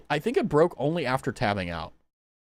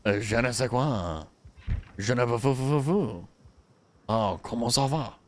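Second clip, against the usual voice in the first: mock French; 4 semitones lower than usual.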